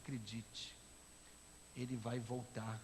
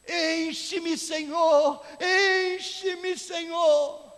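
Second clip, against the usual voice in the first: very loud, high full-voiced shout; crying out; 17 dB louder, much slower than usual. Man coming down the church aisle to God, crying out. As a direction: yell it out as a drawn-out plea. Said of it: "veio pelo corredor gritando".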